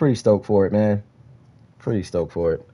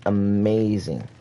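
slow drawn-out amazing; 2 semitones lower, much slower than usual. Real, not acted.

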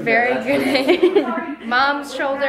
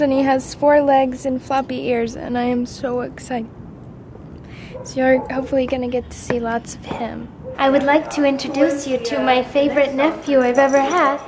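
normal talking voice

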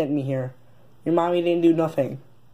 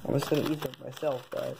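quick quiet mutter; 3 semitones lower, 11 dB quieter, faster than usual. Acted. Muttering to self.

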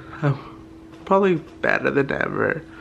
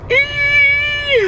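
excited high-pitched squeal; 4 dB louder than usual. Real, not acted. Squealing hello to camera.

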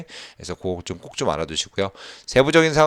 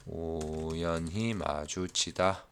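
very slow, soft and low, syllables drawn out; slow dictation; 5 semitones lower, 11 dB quieter, much slower than usual. Real, not acted. Teacher noting the meaning for students, slow and quiet. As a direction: stretch it out quietly, as if writing along. Writing out the meaning just explained.

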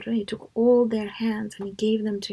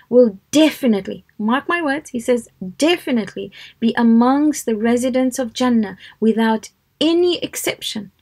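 "definitely" punched louder each time; emphatic certainty; 5 dB louder than usual. Retold or imitated. Preacher relaying a promise to the congregation, emphatically certain. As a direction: lean hard on "definitely" and "any exception".

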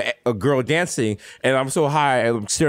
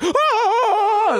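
high, mock diva wail; drag queen belting. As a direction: belt it out like a drag performer. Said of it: "like fucking drag queen over here performing".

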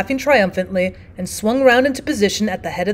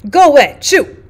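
cried out; 4 semitones higher, 6 dB louder than usual. Acted. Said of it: "he cried".

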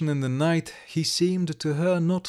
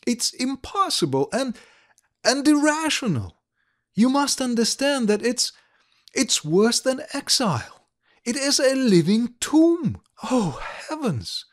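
hotly; 8 semitones higher than usual. Acted. Ilovaisky pleading with Likharev not to go, hotly. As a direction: plead hotly, voice climbing high. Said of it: "she said hotly".

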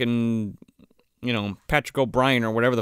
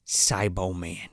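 acted-out quiet, solemn reveal; mock hushed revelation; 8 semitones lower, 5 dB quieter than usual. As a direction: reveal it gravely, drawing it out. Reluctant witness names the killed one.

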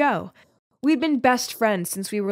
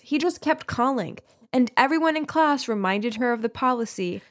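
ordinary delivery

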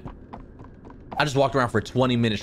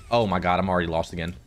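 ordinary delivery

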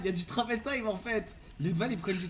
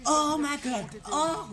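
shocked exclamation; 8 semitones higher, 7 dB louder, much slower than usual. Real, not acted. Sudden shock in game.

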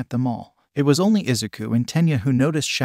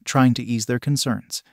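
ordinary delivery, nothing marked

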